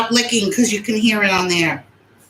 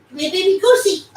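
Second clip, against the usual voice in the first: high, louder cooing call; 11 semitones higher, 4 dB louder, faster than usual. Real, not acted. Mommy calls the dog onto the bed, high baby talk. Coaxing her dog over.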